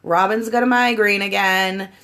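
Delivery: loud, sarcastic mock boss; imitating skeptical boss. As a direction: play the boss not buying the excuse. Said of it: "your boss probably is like".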